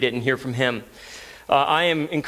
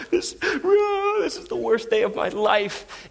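high, slow, hammed-up wailing; mock weeping; 12 semitones higher, slower than usual. Dad imitating his six-year-old on her knees, mock weeping. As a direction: imitate a child sobbing in despair. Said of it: "on her knees weeping".

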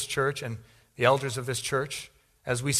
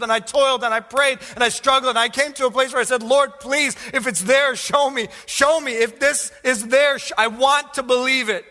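high, loud, impassioned sermon; full-force preaching; 11 semitones higher, 9 dB louder than usual. Real, not acted. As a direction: plead it out at the top of your preaching voice.

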